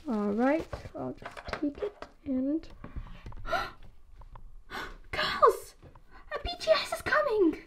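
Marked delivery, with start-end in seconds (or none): 3.4-7.6 high, breathy fan squeal; thrilled gasp. Acted. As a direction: gasp as the idol appears. Spots idol arriving, excited gasp.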